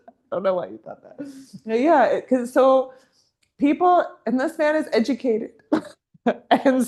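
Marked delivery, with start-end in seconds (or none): 0.1-1.2 softer and very fast, tossed off; fast mutter. Real, not acted. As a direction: rattle it off under your breath.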